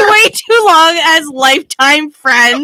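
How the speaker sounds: high, loud laughing reply. Real, not acted. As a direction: laugh through the words. Laughing echo of friend.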